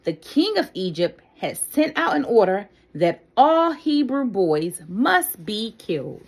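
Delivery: ordinary delivery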